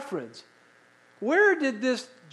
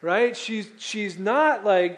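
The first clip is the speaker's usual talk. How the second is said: ordinary talk, nothing marked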